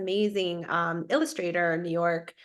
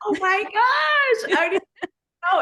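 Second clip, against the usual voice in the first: shocked; 11 semitones higher, 6 dB louder than usual. Real, not acted. Asked for a dying cat sound.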